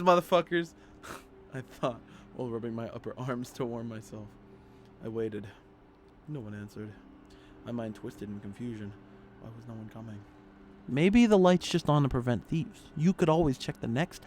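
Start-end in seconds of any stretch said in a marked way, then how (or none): 1.6-10.2 lower, measured reading voice; story narration. Acted. Player reading horror game text, in subdued narration. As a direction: read the horror story aloud evenly. Reads the game's story aloud.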